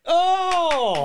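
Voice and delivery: long high-pitched delighted "Oh!"; ecstatic. Real, not acted. Host hears the Oracle chose his actor, ecstatic. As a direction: cry out in real, surprised triumph. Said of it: "obviously I'm ecstatic".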